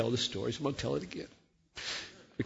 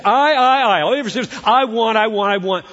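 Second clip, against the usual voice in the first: higher, louder whining; mock greedy child; 10 semitones higher, 10 dB louder than usual. God's imagined selfish demand, high and whiny. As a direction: whine like a spoiled brat. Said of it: "he wouldn't say".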